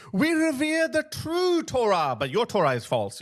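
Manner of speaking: mock proclamation.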